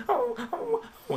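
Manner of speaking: high-pitched dog whine; whining dog. Retold or imitated. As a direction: imitate an eager dog whimpering. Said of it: "he's just whining".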